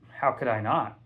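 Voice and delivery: ordinary delivery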